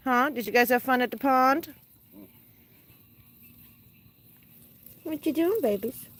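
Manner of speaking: high sing-song pet talk. Real, not acted. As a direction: coo at the birds. Talking to the pet birds.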